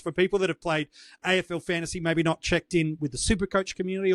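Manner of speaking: ordinary talk, nothing marked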